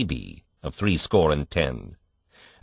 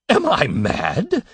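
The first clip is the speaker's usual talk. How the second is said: higher, slow and weighty outcry; thundered; 11 semitones higher, 3 dB louder, much slower than usual. A narrator's reading. Father at the hospital crib, thundering in slow disbelief. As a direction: roar it out slowly in disbelief. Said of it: "thundered Mister Button".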